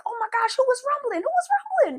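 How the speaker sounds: very high, loud comic squeal; Martin. Retold or imitated. Pupil asking her friend who was fighting, Martin voice. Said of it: "in my best Martin voice".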